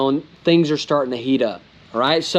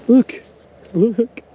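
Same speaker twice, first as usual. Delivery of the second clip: excited; 9 semitones higher, 3 dB louder than usual. Real, not acted. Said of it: "you know that I get excited".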